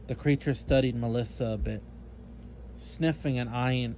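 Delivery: usual speaking voice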